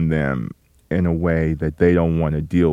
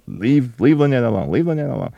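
retold sister's demand; 5 semitones higher than usual. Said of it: "her sister is telling me".

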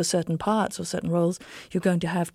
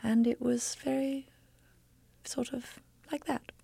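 former high-pitched voice; 8 semitones higher than usual. Shown as a demonstration. Actress showing what her untrained voice used to sound like, high-pitched. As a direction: go high, placed back, trailing to a whisper. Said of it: "I'm not sure if I could do it".

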